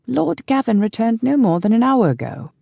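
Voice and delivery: put-on high-pitched old servant's greeting; old man. A narrator's reading. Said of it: "said an old man".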